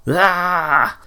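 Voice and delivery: high, drawn-out cry of fright; startled scream. A narrator's reading. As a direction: scream as you recoil from a bear. Wilmot recoils from a bear.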